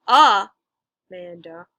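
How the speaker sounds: "Amanda" as AH-manda, first syllable loud and drawn out; wrong first-syllable stress. Shown as a demonstration. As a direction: punch the opening A of "Amanda" hard, then drop. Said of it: "the second syllable is stressed".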